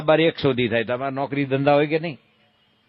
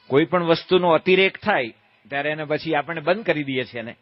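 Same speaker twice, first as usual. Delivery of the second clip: ordinary talk, nothing marked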